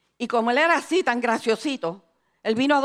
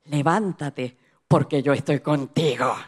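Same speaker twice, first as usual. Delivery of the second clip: low, slow, solemn reassurance; steady her in a deep, measured divine voice; voice of God; 7 semitones lower, slower than usual. Retold or imitated. God tells the fearful preacher to rise, low solemn voice. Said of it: "escucho la voz de Dios que me dice".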